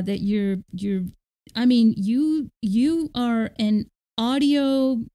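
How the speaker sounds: ordinary talk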